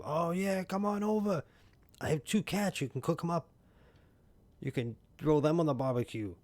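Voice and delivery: high, loud, gushing welcome; high excited invite. Acted. Streamer gushing back at Jim's barbecue plan, high and excited. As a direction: squeal the invitation like a giddy host.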